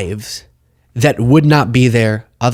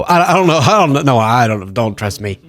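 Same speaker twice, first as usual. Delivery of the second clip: mock disclaiming. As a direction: imitate a flustered expert ducking responsibility. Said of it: "speak like an expert, but then go".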